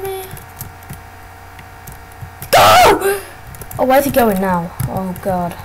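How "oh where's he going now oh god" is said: usual speaking voice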